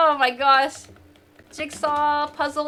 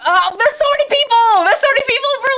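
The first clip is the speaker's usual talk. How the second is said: high and loud, thrilled; excited; 10 semitones higher, 7 dB louder than usual. Real, not acted. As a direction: gush at the huge turnout. Surprised by many players.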